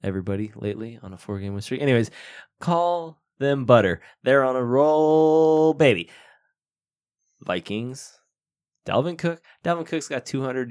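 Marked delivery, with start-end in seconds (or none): 2.6-6.1 slow, higher, louder punchline; milk the pun slowly; hammed-up pun delivery. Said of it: "my awesome group chat pun".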